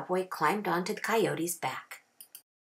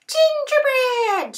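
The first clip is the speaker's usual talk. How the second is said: high, slow squealing shout; javelinas shouting; 10 dB louder, slower than usual. A narrator's reading. Javelinas spotting the cookie, high squealing shout. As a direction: squeal it like a hungry wild pig. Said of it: "shouted the javelinas".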